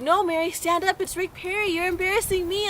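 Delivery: high-pitched mock protest; hammed-up insistent pleading; indignant dad. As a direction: imitate a dad scolding back, pitched right up. Dad argues back in retold quarrel.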